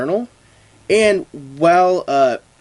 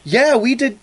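mock coworker voice; 10 semitones higher, 3 dB louder than usual. Coworkers voiced in retold scene.

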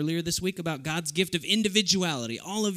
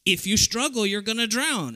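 mother's stern warning; 6 semitones higher, 4 dB louder than usual. Retold or imitated. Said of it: "And she's like".

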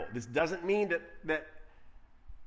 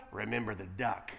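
sly whisper; 8 semitones lower, 8 dB quieter than usual. Retold or imitated. Sister quietly reminding Johnny of the duck, soft sly whisper. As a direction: lower and much softer after the bright "Right, Johnny?". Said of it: "Right, Johnny?".

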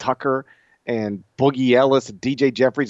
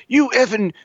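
put-on angry kid; 10 semitones higher than usual. Voicing his angry six-year-old.

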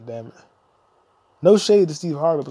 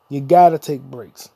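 louder, slower, leaning on gotta; louder insistence; 3 semitones lower, 7 dB louder than usual. Shown as a demonstration. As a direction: hammer the point home a second time. Repeats the advice to stress it.

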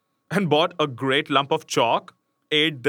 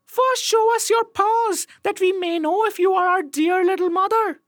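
put-on kids crying out; 3 dB louder than usual. A narrator's reading. Kids testing the wolf at the door, high cautious cries. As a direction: squeak a wary demand up high. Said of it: "The little kids cried".

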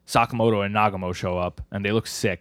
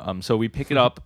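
normal talking voice

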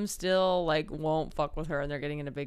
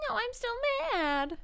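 high whiny mock sulk; sulky teammate, hammed up. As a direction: whine it like a pouting boy. Sulking boy voiced.

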